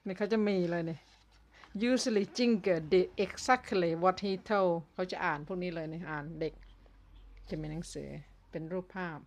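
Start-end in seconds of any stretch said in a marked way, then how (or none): 1.9-4.7 slow clear English. Shown as a demonstration. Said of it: "ต้องพูด ภาษาให้ชัดนะคะ".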